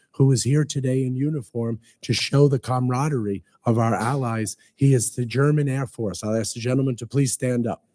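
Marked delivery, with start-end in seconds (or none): none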